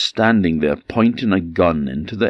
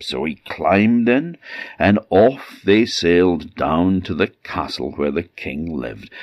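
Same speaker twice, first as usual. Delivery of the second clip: plain reading voice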